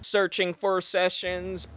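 drawn-out loading-screen read; slow robotic announcement. Pretend. Reads loading screen aloud.

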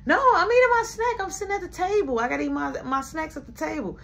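put-on high, fast protest from a child who won't budge; whiny child. Child refusing to leave the table, high and whiny. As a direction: squeak it out like a stubborn kid at the table.

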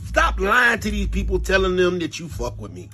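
ordinary talk, nothing marked

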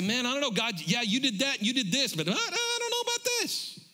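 a hint of an unsure believer, last line squeaking very high; lightly voiced hesitant churchgoer; doubting believer. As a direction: voice the doubter's wavering.

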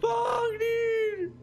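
high, crying curse; real high-pitched panic; frightened wail. Real, not acted. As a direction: wail it out in shock. Reeling from jump scare.

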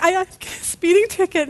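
stammered, high mock crying; hammed-up tearful stammer; imitated sobbing. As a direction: choke it out in pretend sobs. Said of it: "she's crying and sobbing".

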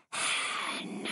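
unvoiced ghostly whisper, drawn out; dry whisper. A narrator's reading. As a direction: breathe the name like a haunting shadow. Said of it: "The dry whisper".

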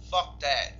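emphatic dismissal. Real, not acted.

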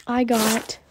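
usual speaking voice

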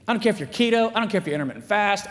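normal talking voice

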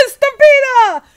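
a big, loud, high yell; loud shout. A narrator's reading. Cowboys warning of a stampede, loud shout. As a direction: yell it across the field. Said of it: "Los vaqueros gritaron".

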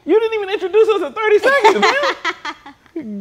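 high, laughing disbelief; laughing protest. Real, not acted. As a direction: protest through real laughter. Shocked at minute plank.

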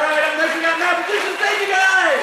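loud laughing thanks; laughing shout. Real, not acted. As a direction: crack up and yell it out.

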